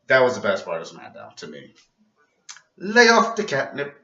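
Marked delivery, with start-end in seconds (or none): 2.8-4.0 high, loud Cosby-style scolding; mock Cosby lecturing a kid; Bill Cosby impression. Vlogger redoes Charlotte's line as Bill Cosby. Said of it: "Like she was Bill Cosby".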